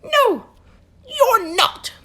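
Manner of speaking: high, loud nervous elf; fretting little elf official; fussy elf voice. Acted. Elf character voice.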